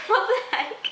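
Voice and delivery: laughing disbelief. Retold or imitated. Vlogger laughing at a rude reply to a compliment, high and laughing. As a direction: laugh through the words in disbelief.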